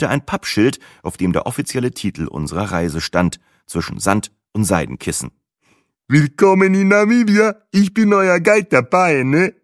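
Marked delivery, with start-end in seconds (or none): 6.1-9.5 high, loud, exuberant guide voice; überschwänglicher Tourguide. Acted. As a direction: greet them loudly, pitched way up, tag question at the end. Tourguide begrüßt die beiden. (fröhlicher Reiseleiter, hoch und laut)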